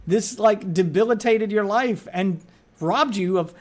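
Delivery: usual speaking voice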